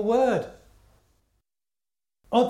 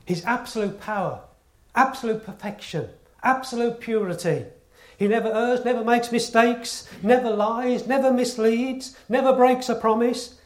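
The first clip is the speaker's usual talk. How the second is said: building sermon; 5 semitones higher, 5 dB louder than usual. Acted. Preaching builds through list.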